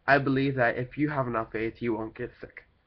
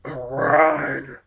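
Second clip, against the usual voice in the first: nose yelling run; 3 semitones higher, 8 dB louder than usual. Retold or imitated. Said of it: "And my nose was like".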